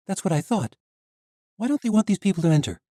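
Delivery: put-on high, loud young heroine; defiant young woman. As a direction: stand up to the guard. Misha presses the knight.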